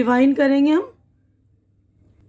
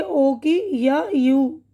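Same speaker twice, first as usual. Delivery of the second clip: ordinary talk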